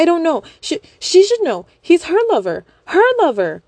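high, loud flustered stammer; stammering high panic. Acted. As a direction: stutter out a frantic lie. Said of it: "stuttering to defend herself".

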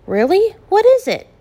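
high, loud eager questions; bursting with eager curiosity; excited. A narrator's reading. Eva pressing her father about news, excitedly. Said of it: "Eva asked with much excitement".

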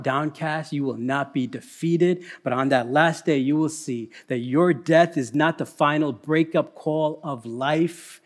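ordinary talk

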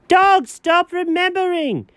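high, drawn-out protest; exasperated high-pitched command; exasperated order. Acted. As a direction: cut them off, high and slow. Exasperated order to stop reminiscing.

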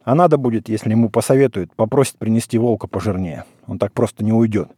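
ordinary delivery, nothing marked

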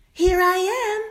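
high, bright sing-song call; delighted. Acted. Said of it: "sang out the mermaid in delight".

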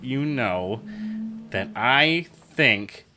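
slow, drawn-out stalling; stalling for time. Real, not acted. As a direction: stretch the words to buy time. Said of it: "I'm stalling for time".

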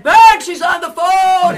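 loud, high drawn-out holler; re-enacted excited shout; calling out. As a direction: yell to someone in another room. Calling out to the wife, retold.

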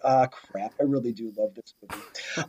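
low, muttered retold confession; grudging aside to oneself; reluctant admission. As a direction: drop low and soft, owning up reluctantly. Quotes own reluctant admission to herself.

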